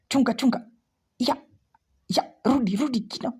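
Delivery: high, pleading urging; urging the chart. Real, not acted. Trader begging the candle to turn, high urging. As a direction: coax the price back, pitch up. Urging the price back live.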